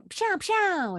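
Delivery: high mouth-made effect sounds; sound effect noises. Shown as a demonstration. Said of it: "that's what they would have sounded like".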